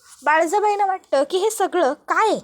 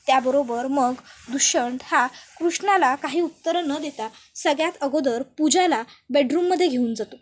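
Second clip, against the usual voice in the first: ordinary delivery, nothing marked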